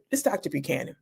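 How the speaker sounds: softly, firmly says her title; a low, quiet insistence on the title; quiet correction. Retold or imitated. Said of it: "quietly said".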